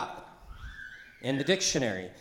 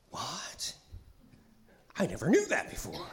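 hushed amazement then rising; astonished; 4 semitones higher than usual. Shown as a demonstration. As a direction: breathe it out in wonder. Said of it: "Epiphany is a".

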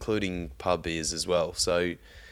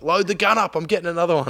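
much higher and louder, eager mock shout; 11 semitones higher, 7 dB louder, faster than usual. Mate demanding the gun be reloaded, eager and loud. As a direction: mimic the mate hungry for another shot. Kev wants another fish.

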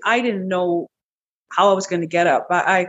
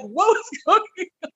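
high voice breaking into a laugh; laughing disbelief; 10 semitones higher than usual. Retold or imitated. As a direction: laugh through the question. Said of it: "And I was like".

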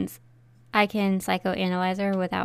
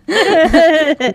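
lautes Lachen beim Nein; laughing no; 7 semitones higher, 13 dB louder than usual. Real, not acted. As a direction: prustet das Nein lachend heraus. Laughing at death by cat joke.

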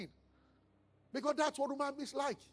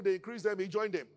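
usual speaking voice

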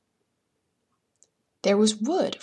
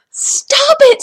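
high, loud scream; full-force frantic yell; 12 dB louder than usual. A narrator's reading. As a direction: shriek it at the top of your lungs. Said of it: "screamed Jack at the top of his lungs".

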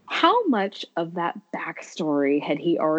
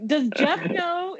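higher, slow, drawn-out question; deadpan line; 11 semitones higher, slower than usual. Retold or imitated. Fan quoting the old actor's line slowly and higher. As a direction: ask it slowly, oddly innocent.

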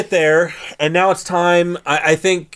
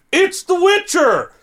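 high, long-drawn holler; mock announcing call; 13 semitones higher, slower than usual. Calling to the ships from the shore, mock announcing call. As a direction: sing it out high as if across water.

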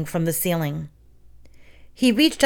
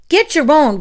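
higher, louder refusal; protest; 8 semitones higher, 6 dB louder than usual. A narrator's reading. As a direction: push back indignantly. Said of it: "Glenn protested".

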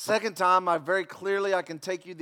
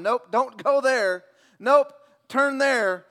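slow, louder, a guiding GPS voice; God as a GPS; 6 semitones higher, 10 dB louder, much slower than usual. Pretend. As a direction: give directions like God as a sat-nav. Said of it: "I'd love to hear just God".

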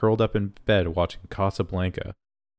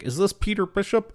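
high, tentative phone query; cleaning lady; 11 semitones higher than usual. Retold or imitated. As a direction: put on a higher-pitched woman asking. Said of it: "it's the cleaning lady".

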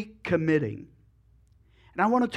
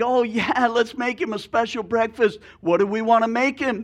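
higher, louder, delighted pig; eager pig; 5 semitones higher, 3 dB louder than usual. Acted. Pig cheering the breakfast plan to the chicken, excited. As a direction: jump on the idea with glee.

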